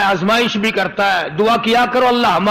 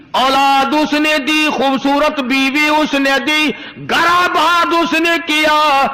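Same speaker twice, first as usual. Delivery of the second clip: slow, higher, stressing اس each time; emphatic preaching; 4 semitones higher, slower than usual. Acted. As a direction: drive home each blessing slowly. (ہر جملے میں "اس" پر زور دے کر آہستہ، اونچی آواز میں وعظ)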